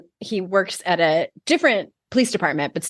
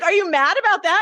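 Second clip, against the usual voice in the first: high mock question; 12 semitones higher than usual. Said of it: "And he's like".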